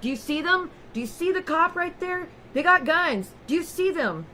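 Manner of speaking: panicked pointing out imagined police; frantic paranoid husband. Retold or imitated. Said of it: "He's like".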